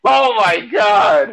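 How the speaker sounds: high, slow, loud gasping exclamation; real shock, drawn out and loud; shocked oh my God. Real, not acted. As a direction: stretch it out in disbelief. Reacting to niece found sleeping.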